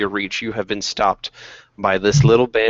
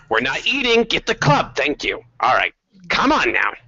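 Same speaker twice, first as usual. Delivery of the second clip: bossy goblin leader; 3 semitones higher than usual. Acted. Goblin leader directs his band out with clubs, increasingly exasperated.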